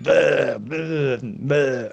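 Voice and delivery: slow, mock crazy rambling noises; Alex Jones. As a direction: drag out each blah like a half-hearted lunatic. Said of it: "your Alex Jones is crazy".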